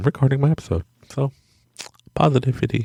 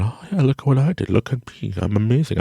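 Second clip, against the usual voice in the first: pompous; 7 semitones higher than usual. Retold or imitated. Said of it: "becoming a pompous, going".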